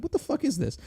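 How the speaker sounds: high-pitched mock disbelief; incredulous. Patient reacting to a watched testicle exam, incredulous. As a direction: squeak it out in retold bewilderment.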